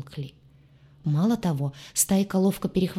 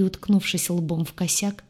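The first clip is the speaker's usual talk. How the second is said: ordinary delivery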